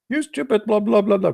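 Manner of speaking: high-pitched imitation of angry chatters; mock cursing. Chat room users cursing the newcomer, mock cursing. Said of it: "everybody starts cursing me".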